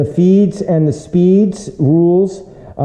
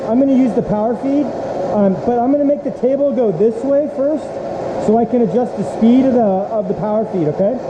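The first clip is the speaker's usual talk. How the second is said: raised, high-pitched voice over the noise; shouting over machinery; 6 semitones higher than usual. Real, not acted. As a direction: call out above the running mill. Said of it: "I'll be yelling over the machine".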